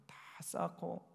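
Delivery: ordinary talk, nothing marked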